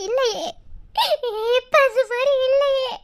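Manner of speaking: ordinary delivery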